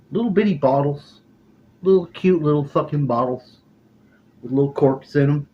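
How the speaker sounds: put-on playful baby-talk lift; cutesy voice. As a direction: make the bottles sound adorable. Cutesy description of tiny bottles.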